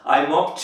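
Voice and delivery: "mopped" with a released "t" ending; correct -ed sound. Shown as a demonstration. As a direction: finish "mopped" as "mopt". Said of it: "right".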